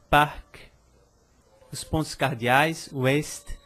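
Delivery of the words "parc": "parc" with the c sounded as k; French "parc" ending in an audible k; final consonant pronounced. Shown as a demonstration. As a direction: release a clear k at the end of "parc". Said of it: "e palavras com C".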